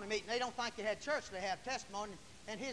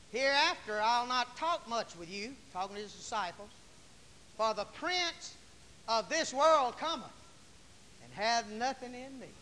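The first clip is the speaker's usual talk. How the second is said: loud, raised scripture declaiming; forceful preaching; 4 semitones higher, 6 dB louder than usual. Real, not acted. Preacher assuring congregation the devil has no hold, forceful. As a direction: drive the promise home to the church.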